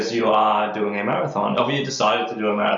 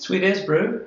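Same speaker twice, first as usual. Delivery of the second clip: higher, hammed-up Kiwi sweet as bro; broad kiwi accent; 9 semitones higher, slower than usual. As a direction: lay the Kiwi slang on thick. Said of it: "You get more Kiwi every time you say that".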